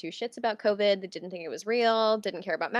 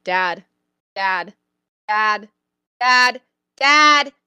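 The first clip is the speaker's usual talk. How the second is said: repeated calls growing louder and higher; pestering child; 3 semitones higher, 14 dB louder, much slower than usual. Retold or imitated. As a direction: keep calling Dad, louder each time. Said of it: "yelling in the backyard".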